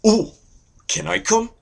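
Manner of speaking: high, eager little-brother plea; eager Canada. Acted. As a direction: beg high and excited to tag along. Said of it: "And Canada's like".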